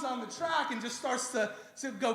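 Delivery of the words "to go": usual speaking voice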